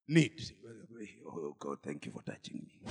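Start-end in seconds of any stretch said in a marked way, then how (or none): 1.2-2.8 whispered much lower, hidden prayer; husband's whispered thanks to God, out of sight of the church; secret whisper. Pretend. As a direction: hush it like praying alone in the toilet. Couple secretly crying in toilet.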